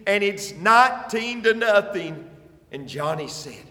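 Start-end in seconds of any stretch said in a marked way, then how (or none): 0.1-2.3 a mild mock yell across the field; called out from the fence. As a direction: call it out to the boy, lightly raised. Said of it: "yelled and said".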